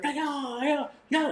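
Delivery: 훨씬 높은 목소리로 흉내낸 무술 기합 "yeah"; imitating martial arts guy. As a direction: mimic a wannabe kung fu fighter's high cry. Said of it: "He's like".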